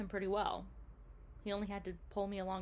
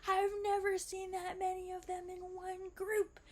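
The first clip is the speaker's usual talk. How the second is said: much higher, thrilled exclamation; excited guide; 11 semitones higher than usual. Retold or imitated. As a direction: sell his amazement at the stingrays. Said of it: "He was very excited".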